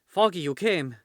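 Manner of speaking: high, loud cry of relief; excited greeting. Acted. Glory greets Foggy who came to see her, excited. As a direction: burst out at the friend's arrival.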